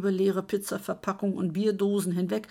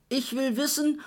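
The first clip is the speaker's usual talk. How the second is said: higher, raised yell with ears still ringing; shouting; 6 semitones higher than usual. A narrator's reading. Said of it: "schrie Kurt".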